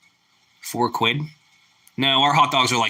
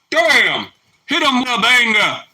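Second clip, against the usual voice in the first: higher, louder hype; excited food hype; hyped shout; 9 semitones higher, 4 dB louder, slower than usual. Real, not acted. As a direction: hype it up like a banger.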